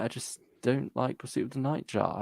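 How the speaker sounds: ordinary talk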